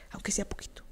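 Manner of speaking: aunque sea poquito in a soft whisper; a hushed, pleading whisper. Pretend. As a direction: whisper it like a secret plea. Mimics someone begging for scraps.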